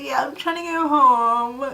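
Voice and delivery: super dramatic. Retold or imitated. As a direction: overplay total helplessness. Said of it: "Super dramatic".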